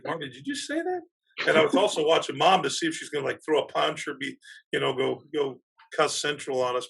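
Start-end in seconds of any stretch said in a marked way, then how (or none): 0.1-1.0 high, hushed disbelief; shocked aside. Retold or imitated. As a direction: softly check with a colleague, incredulous. Retells his shocked aside to Marty.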